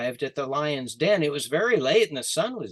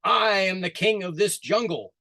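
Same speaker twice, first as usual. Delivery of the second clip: lion boasting; 6 semitones higher, 4 dB louder than usual. Acted. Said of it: "the lion said".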